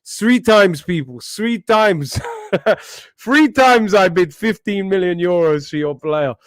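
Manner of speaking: mock Mourinho in a Portuguese accent; Portuguese-accented football manager boast. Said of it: "prime Lisbon accent I had then".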